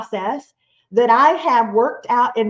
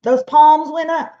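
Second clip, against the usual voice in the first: ordinary talk, nothing marked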